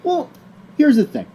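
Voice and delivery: ordinary talk, nothing marked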